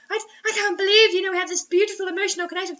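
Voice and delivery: high, fast, gushing parody; mock gushing lover. Co-host mocking the lovers' lines with a gushing parody. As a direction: ham up a swooning lover.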